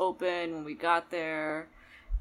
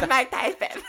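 high, loud laughing Tyson lisp; Mike Tyson impression; 6 semitones higher, 8 dB louder than usual. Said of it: "that's how Mike Tyson sounds".

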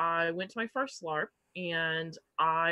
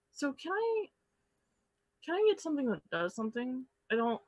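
timid request; 7 semitones higher than usual. Retold or imitated.

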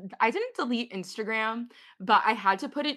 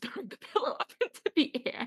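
higher, breaking into a laugh; laughing explanation; 7 semitones higher than usual. Real, not acted. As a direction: explain it while cracking up. Amused explaining own odd habit.

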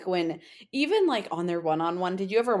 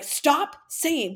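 exasperated retort; 9 semitones higher, 4 dB louder than usual. Retold or imitated. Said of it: "but not in a genuine way".

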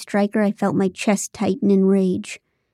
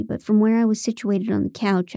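ordinary delivery, nothing marked

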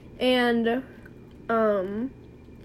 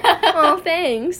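higher, louder mock-touched reply; acted gushing thanks; 6 semitones higher, 7 dB louder than usual. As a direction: play up being flattered by the compliment. Retelling her reply to a compliment.

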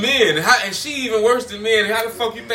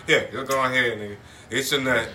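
lower, bored, dismissive wife voice; 6 semitones lower, 4 dB quieter than usual. Retold or imitated. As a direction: play a wife who just lies there, uninterested. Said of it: "she gonna lay there".

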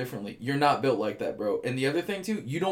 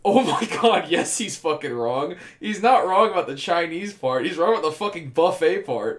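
amused outrage; 4 semitones higher, 6 dB louder than usual. Real, not acted. Indignant laughing verdict on date.

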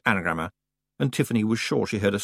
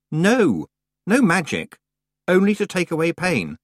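firm; 9 semitones higher, 4 dB louder than usual. A narrator's reading. Tiffany firm refusal.

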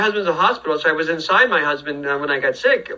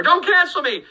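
high-pitched comic panic; hammed-up frantic protest; mock pleading; 12 semitones higher than usual. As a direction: plead in a high voice, half laughing. Mock plea after accent joke.

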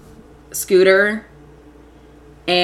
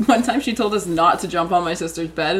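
ordinary talk, nothing marked

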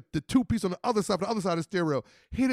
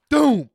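loud, higher "Doom" like a crash; imitated impact boom; 6 semitones higher, 10 dB louder than usual. The club hitting the car, loud boom. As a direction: smack the sound out.

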